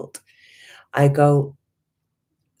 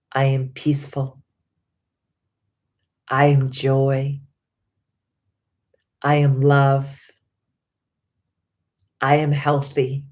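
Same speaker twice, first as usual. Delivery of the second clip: affirmation recital. Acted. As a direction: speak each affirmation like a promise to yourself.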